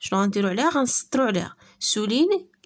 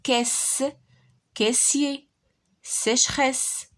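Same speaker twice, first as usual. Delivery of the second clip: ordinary talk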